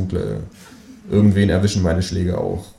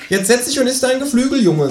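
bossy kangaroo. A narrator's reading. Kangaroo orders narrator to sit.

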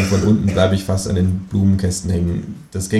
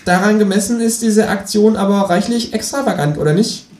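kangaroo voice; 13 semitones higher, 3 dB louder than usual. Acted. The kangaroo speaks.

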